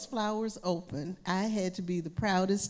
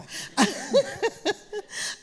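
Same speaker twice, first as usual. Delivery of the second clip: high laugh; 13 semitones higher, 3 dB louder than usual. Real, not acted. Laughing at own story.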